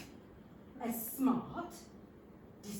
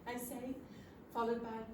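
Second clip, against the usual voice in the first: ordinary delivery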